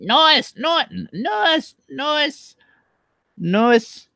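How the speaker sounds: squeaky high loud cartoon voice; Animaniacs voice. Streamer attempts an Animaniacs character, high and loud. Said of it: "I can't do the Animaniacs right now".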